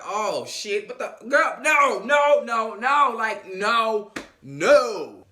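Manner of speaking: incredulous shouting. Retold or imitated. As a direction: shout them down in disbelief. Whole group shouting disbelief.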